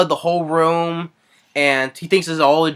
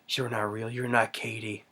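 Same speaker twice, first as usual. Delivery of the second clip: low, very soft acted murmur; under the breath, low and subdued; hushed denial; 5 semitones lower, 13 dB quieter than usual. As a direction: play a father quietly refusing to believe. Retelling father rejecting daughter's ghost.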